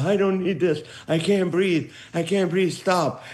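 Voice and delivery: put-on high-pitched complaining old grandpa; breathless grandpa. Runner's lungs protesting as the grandpa, breathless high complaint. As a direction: plead like a grandpa who can't go on. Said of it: "The grandpa".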